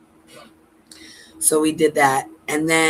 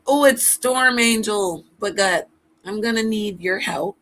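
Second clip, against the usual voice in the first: ordinary talk, nothing marked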